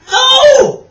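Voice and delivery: very high, very loud scream; cried. Acted. Alison refusing George's jar with a loud scream. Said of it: "cried Alison".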